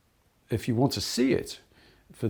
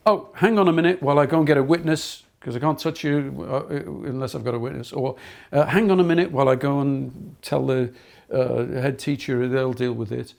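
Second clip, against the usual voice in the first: louder, quick officious brush-off; mock officious; 4 semitones higher, 6 dB louder than usual. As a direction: parody a rule-bound teacher stalling. Mock wrong teacher response.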